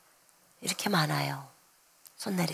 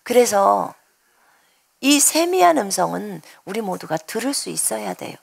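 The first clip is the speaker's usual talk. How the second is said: ordinary talk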